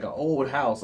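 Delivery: ordinary delivery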